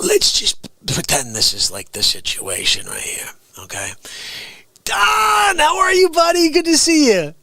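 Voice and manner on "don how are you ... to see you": put-on big, high calling out to an old friend; loud hearty greeting. Host calling out to a friend in the skit, loud and hearty. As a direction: boom out a hearty hello. Greeting someone in mock scene.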